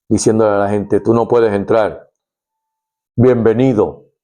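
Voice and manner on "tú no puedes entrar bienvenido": bouncer voice. Retold or imitated. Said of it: "un guardaespaldas frente a una puerta".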